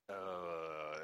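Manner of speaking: long low mock groan; drawn-out, quiet groan of annoyance, imitated; imitated exasperated groan. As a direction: let out a low, put-on "uhhh". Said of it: "and Kirk is like".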